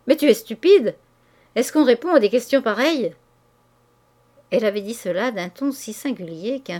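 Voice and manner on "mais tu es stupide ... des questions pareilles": sharp, louder snap with a raised pitch; abrupt retort. A narrator's reading. Wife rebuffing her husband's question with a loud, abrupt snap. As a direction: cut him off hard and loud. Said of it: "déclara brusquement".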